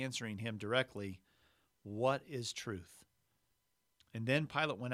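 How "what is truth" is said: slow, tired, drawn-out question; weary. Shown as a demonstration. Pilate fed up with the whole trial, asking wearily. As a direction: let it sag with exhaustion, slowly. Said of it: "I almost imagine him saying it, just weary".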